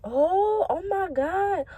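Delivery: high, breathy gasps of shock. Retold or imitated. The friend at the table gasping in shock. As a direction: gasp like you just saw tea spill everywhere. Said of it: "she was like".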